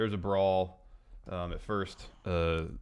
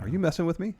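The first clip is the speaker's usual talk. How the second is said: higher-pitched questioning, lightly hammed up; mock incredulity; 9 semitones higher, faster than usual. Host playing a wrestler baffled by his rival's taunt, mock incredulity. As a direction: ask it high, in mock disbelief. Acts out AJ's repeated reply.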